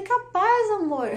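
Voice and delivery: high, loud burst of dismissal, then easing; mock incredulous reply. Wife brushing off husband's test suggestion, mock incredulous. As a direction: exclaim it as if the idea is absurd.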